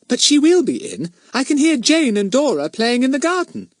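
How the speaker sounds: put-on very high child voice; Betts, eager little girl. A narrator's reading. Little girl assures her nervous friend someone is home, eager. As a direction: chirp it confidently as a small girl.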